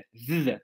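"v" held as a long voiced buzz; v sound. Shown as a demonstration. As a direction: sustain the consonant "v". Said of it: "a diferença do ev".

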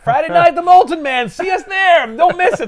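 high, loud hyped promo voice; goofy band plug. Retold or imitated. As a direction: sell the gig like a dopey teen. Kid plugs his band loudly.

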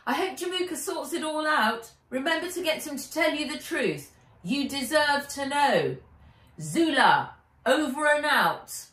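earnest farewell, then radio style; Wen signing off. A narrator's reading. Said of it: "said Wen".

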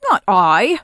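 loud, sulky refusal; sulky camel. A narrator's reading. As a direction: refuse loudly, in a camel's sulk. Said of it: "said the sulky camel".

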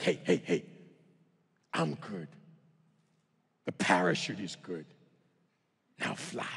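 low, soft, sparse reassurance; calm a panicking jumper with long pauses; deadpan foreign instructor, very low-pitched; limited-English instructor. Acted. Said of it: "barely speak any English".

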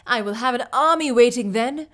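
the king shouting his plan in triumph; cried out. A narrator's reading. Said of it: "cried the king".

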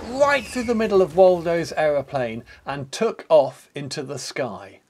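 lively read-aloud narration; story narration. A narrator's reading. Narrator telling children about the race, lively read-aloud. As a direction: tell the race to children with gusto.

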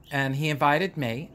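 ordinary delivery

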